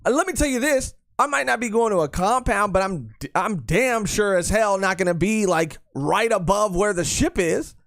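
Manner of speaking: high, animated rant; excited rant. Real, not acted. Ranting excitedly, pitch raised.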